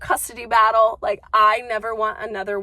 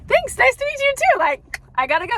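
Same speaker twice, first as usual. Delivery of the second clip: high, bright hurried brush-off; chirpy polite exit; quick high thanks to get away; brushing off a stranger; 5 dB louder than usual. Retold or imitated. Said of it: "And I was like".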